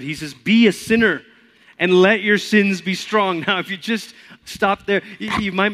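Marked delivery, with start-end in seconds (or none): 0.3-3.4 hammed-up Luther, bold; bold Luther quote. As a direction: declare it boldly and loud. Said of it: "this is how he says it".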